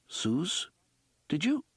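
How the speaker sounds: hushed question. Acted. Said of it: "almost whisper".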